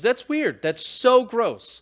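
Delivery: grossed-out outburst, dragging out gross; disgusted. Real, not acted. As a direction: recoil in real disgust. Reacting to a gross tweet.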